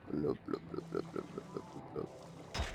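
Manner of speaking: playful low fish noises fading to a whisper; low fish blubs. Pretend. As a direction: gurgle like a sinking fish. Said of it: "cosplaying as a fish".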